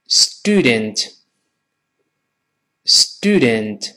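student in slow American style, "stoo-dent"; American model of student, glide dropped; American pronunciation. Shown as a demonstration. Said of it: "所以美国人这么读". (美式发音慢读 student)

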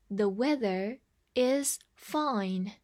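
slow, careful model sentence; slow reading. Shown as a demonstration. Teacher repeats the sentence slowly for learners. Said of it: "再来一遍".

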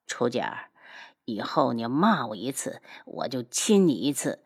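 low, quiet warning; controlled, intimate menace; low threatening suitor. Acted. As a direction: threaten softly and deliberately. Flushed rival threatens a kiss.

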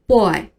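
put-on boy with another wrong vowel glide; mispronounced boy. Shown as a demonstration. Teacher gives another error to avoid, mispronounced boy. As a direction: say boy as a second faulty reading. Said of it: "或者是boy".

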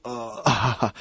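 ordinary delivery

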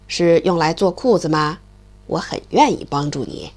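put-on low, gruff old stork. The stork grumbles low at the noisy mole. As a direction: grumble low like a big bird.

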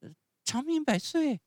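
put-on very high puppet-child voice; polite little Pinocchio. Pinocchio blesses the sneezing showman, high and polite. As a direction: squeak it out like a small puppet.

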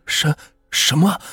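stammered, rising shocked question. Acted. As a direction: gasp it out in disbelief. Shocked cry.

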